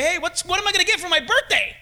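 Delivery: a hint of cheeky child; mock innocent child; child asking. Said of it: "they say".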